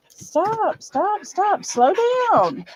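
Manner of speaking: high, insistent pleas to stop; urgent pleading. Real, not acted. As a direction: owner urging her overexcited dog, high and pleading. Urging panting dog to calm.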